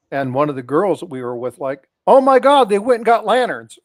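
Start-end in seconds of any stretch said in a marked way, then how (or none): 2.1-3.8 put-on excited girl.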